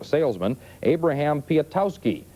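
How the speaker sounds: ordinary talk, nothing marked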